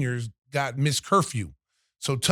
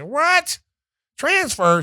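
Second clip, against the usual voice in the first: much higher, louder disbelief; shocked; 12 semitones higher, 5 dB louder, much slower than usual. Retold or imitated. Coach stunned his player wants to transfer, high shocked yelp.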